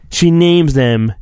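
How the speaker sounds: usual speaking voice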